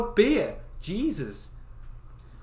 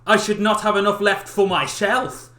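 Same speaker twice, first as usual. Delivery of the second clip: higher, louder snooty refusal; haughty young man; 6 semitones higher, 10 dB louder than usual. Acted. Clever eldest son refusing to share food. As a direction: refuse him with pompous disdain.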